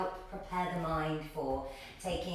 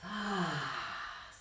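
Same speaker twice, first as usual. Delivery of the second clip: long sighed "ahh" out through the mouth; slow, releasing out-breath; demonstrated exhale; 3 semitones lower than usual. Shown as a demonstration. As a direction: let the breath go with a falling sigh. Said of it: "breathing out through the mouth".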